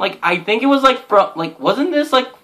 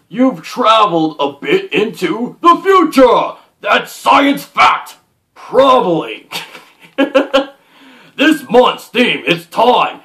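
theatrical announcer-like reading with swooping pitch; hammed-up read-aloud; 4 semitones higher than usual. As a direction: read it out with big dramatic emphasis. Reads the printed welcome note aloud.

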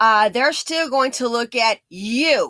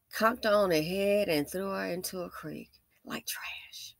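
hushed whisper, voiceless; 3 semitones lower, 8 dB quieter than usual. Real, not acted. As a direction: whisper the grim detail. Grim detail of the murder.

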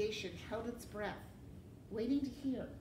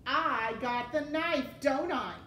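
loud, slow, raised challenge; defiant; 5 semitones higher, 9 dB louder than usual. A narrator's reading. Mig defying the rat with the knife, loud. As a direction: assert it loudly and slowly.

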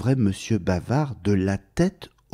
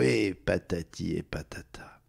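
whispered trailing off; 3 semitones lower, 9 dB quieter than usual. Acted. Mr Bavard's endless chatter fading to a whisper. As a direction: whisper it away to nothing.

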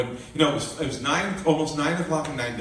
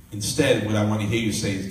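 usual speaking voice

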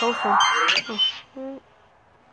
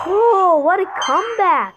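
thrilled exclaim, pitch jumping up; excited cheer; 7 semitones higher than usual. Real, not acted. Gamer cheering a comeback on screen, excited.

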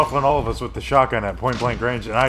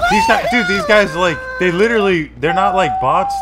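raging gamer; 8 semitones higher, 6 dB louder than usual. Real, not acted. Ranting at the enemy team.

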